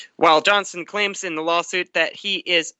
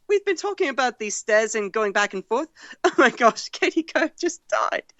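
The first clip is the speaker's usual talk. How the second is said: put-on British accent; 5 semitones higher than usual. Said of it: "He added".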